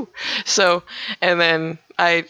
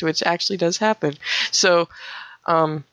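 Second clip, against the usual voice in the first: ordinary delivery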